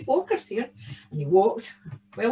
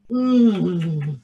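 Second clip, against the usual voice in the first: savouring hum; 5 semitones lower, 5 dB louder than usual. Acted. Savouring bread.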